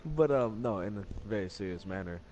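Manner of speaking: ordinary delivery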